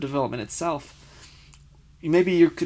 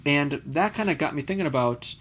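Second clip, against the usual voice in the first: ordinary talk, nothing marked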